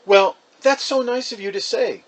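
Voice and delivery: gracious mother thanking the caller; mother pleasantly chatting on the phone; Mom on the phone. Acted. Mom on phone, voiced by narrator.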